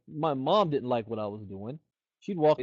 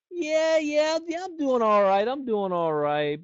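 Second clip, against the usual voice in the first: high, loud mock boast, then slowing down; smug prospect voice pitched up and loud; imitating a bragging prospect; 11 semitones higher, 5 dB louder, slower than usual. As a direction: ham up a guy playing it cool.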